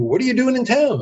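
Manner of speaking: high, chirpy small-talk question; very happy waiter. Retold or imitated. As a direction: imitate a server's eager friendliness. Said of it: "very happy guy says".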